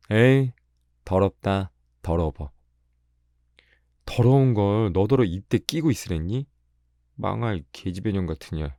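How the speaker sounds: acted scornful girl; disgusted scolding. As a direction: spit out the insults in disgust. Girl flings hen back, cursing.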